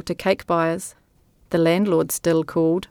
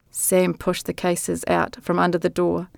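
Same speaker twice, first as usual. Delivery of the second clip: ordinary talk, nothing marked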